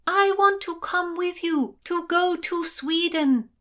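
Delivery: high, drawn-out shout. A narrator's reading. Heroine begs ladies above to take her along, shouting. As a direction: shout the request up to the deck. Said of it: "shouted Constance".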